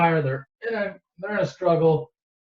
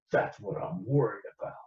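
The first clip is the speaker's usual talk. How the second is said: grim uncle; 6 semitones lower, 6 dB quieter than usual. Retold or imitated. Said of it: "his uncle's like".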